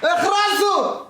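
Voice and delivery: mock outrage.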